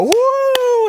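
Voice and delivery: high-pitched whooping cheer; screaming cheer. Acted. Camp kids cheering a friend's baptism, screaming whoop. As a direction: let out a wild, high "woo". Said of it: "just start screaming with applause".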